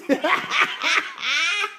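high burst of laughter; high, amused cackle; laughing at own remark. Real, not acted.

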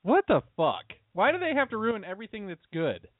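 usual speaking voice

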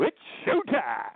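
a slow, hammed-up showman's cry at the office; mock showman. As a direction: announce yourself like a game-show host. Mock entrance at work.

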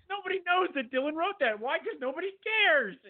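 very high-pitched, comic indignation; exasperated outburst. Real, not acted. Son ranting to his co-host about Dylan, exasperated and high. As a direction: squeal the complaint in mounting disbelief.

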